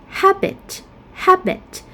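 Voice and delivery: "habit" stressed on HAB; "habit" as HAB-it, weak second vowel; first-syllable stress. Shown as a demonstration. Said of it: "primary stress on the first syllable".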